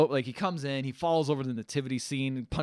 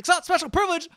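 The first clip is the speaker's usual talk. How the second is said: very high, loud yelled protest; indignant outburst; 9 dB louder than usual. Retold or imitated. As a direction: play a worked-up man arguing back at full tilt. Acted indignant shouting argument.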